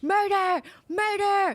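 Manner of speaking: high, loud retold scream; screaming bloody murder. Cook waking the crew at night, screaming. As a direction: cry it out like an alarm in the night. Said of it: "there are these feral screams".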